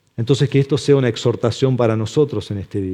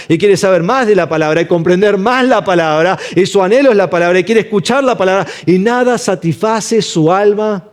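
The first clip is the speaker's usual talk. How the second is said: raised, higher preaching voice, building steadily; fervent preaching; 5 semitones higher, 3 dB louder than usual. Acted. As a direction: preach it with mounting passion.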